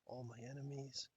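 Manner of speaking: hushed whispered villain musing; whispering. Real, not acted. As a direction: whisper it to yourself. Whispering to himself before noticing live.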